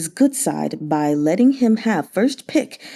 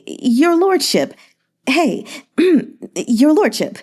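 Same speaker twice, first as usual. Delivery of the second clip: drawn-out wheedling; 6 semitones higher, slower than usual. Acted. Merchant coaxing the governor for attention, drawn-out wheedle. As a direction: stretch lordship long and high, coaxing. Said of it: "dragging out his words".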